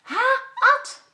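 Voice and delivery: high, loud glad greeting; happy call. Acted. Job in hospital greets his brother, happy call. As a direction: call out delighted to see him. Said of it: "roept Job blij".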